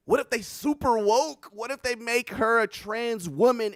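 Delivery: high, loud burst of excitement; worked up and loud; excited brainstorming. Real, not acted. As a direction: blurt out the wild theory. Riffing on a wild idea.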